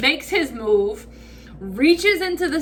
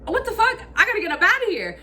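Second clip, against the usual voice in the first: high, fast panic; panicked rush; 9 semitones higher, faster than usual. Retold or imitated. Teller deciding to flee the cop, high and rushed panic.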